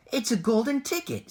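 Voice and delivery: high, loud excited scream. A narrator's reading. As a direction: scream it out in pure astonishment. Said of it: "screamed the shopkeeper".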